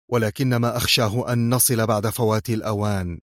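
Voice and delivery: ordinary delivery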